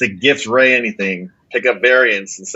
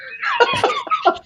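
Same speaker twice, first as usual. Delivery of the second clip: high-pitched burst of laughter; helpless high laugh; real laughter; 3 dB quieter than usual. Real, not acted. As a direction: crack up laughing mid-sentence. Cracks up at co-host's picks.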